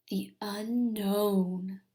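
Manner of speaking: slow eerie whisper; slow hushed whisper, scary to the kids; spooky whisper. Acted. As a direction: breathe it out like a ghost story.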